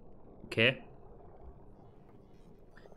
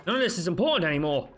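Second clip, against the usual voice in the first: higher, louder and quick; frustrated outburst; 6 semitones higher, 4 dB louder, faster than usual. Real, not acted. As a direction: blurt it out, fed up. Frustrated outburst while playing.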